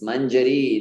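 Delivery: slow mangiare as man-ja-REEE; short middle, long stressed ending on mangiare; correct long final vowel. Shown as a demonstration. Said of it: "Yeah, it's mangiare".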